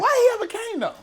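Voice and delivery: high, loud, disbelieving question; incredulous. Real, not acted. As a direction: ask it in total disbelief. Listener stunned by story.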